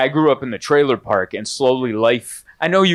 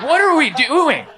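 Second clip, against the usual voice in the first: high incredulous exclamation; 14 semitones higher, 3 dB louder than usual. Retold or imitated. Exasperated self-mockery at juice shop.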